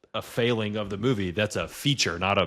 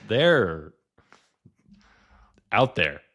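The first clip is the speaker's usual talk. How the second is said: normal talking voice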